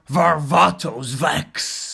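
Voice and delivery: slow, drawn-out name, lightly put-on warrior voice; Varvatos Vex impression. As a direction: announce the name slowly, lightly imitating him. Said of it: "just nailed this impression".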